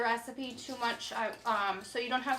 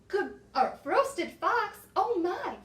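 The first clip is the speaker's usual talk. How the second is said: higher, slow mock alarm; alarmed fox; 6 semitones higher, 4 dB louder, slower than usual. Fox hearing he'll be roasted, mock alarmed. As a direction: gasp it out in hammed-up fright.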